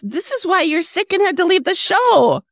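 high, drawn-out mock telling-off; playful exasperated reprimand; mock scolding. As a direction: scold a friend in a hammed-up high voice. Mock scolding read from notes.

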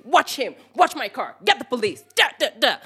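high, punchy shouted orders; retold screaming instructions. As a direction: fire off commands to everyone on the road. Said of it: "Starts screaming instructions".

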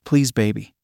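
understated plea, narrated; pleading. A narrator's reading. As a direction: plead gently, without raising the voice. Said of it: "I started in a pleading voice".

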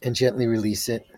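slow, calm meditation voice; soothing guide talking a group through breathing; guided meditation. Acted. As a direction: ease them into stillness, unhurried.

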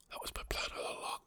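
barely audible mock-secret whisper; conspiratorial whisper. Secret aside after mock cursing.

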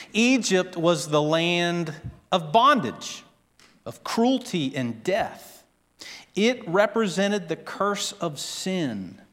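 normal talking voice

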